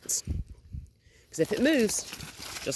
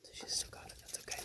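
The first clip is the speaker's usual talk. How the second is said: unvoiced breathy whisper; 18 dB quieter, faster than usual. Real, not acted. Trainer whispering while the puppy keeps the toy. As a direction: barely whisper it.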